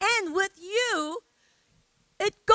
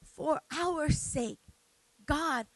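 normal talking voice

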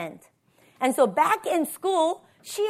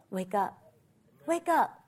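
very soft, urging command; hushed wake-up call; 4 semitones lower, 8 dB quieter than usual. Retold or imitated. Preacher voicing God to the congregation, hushed wake-up call. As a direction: start the call quietly. God's call, quiet first.

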